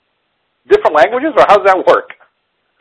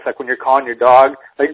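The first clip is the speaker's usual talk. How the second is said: normal talking voice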